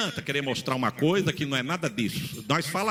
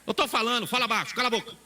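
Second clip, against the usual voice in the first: blunt rebuke; 7 semitones higher, faster than usual. Retold or imitated. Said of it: "Não é assim, irmão?".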